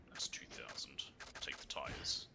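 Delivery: ordinary talk, nothing marked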